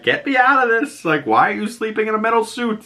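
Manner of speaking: high, exasperated mom voice, mock-incredulous. Mom stuck in airbag shield.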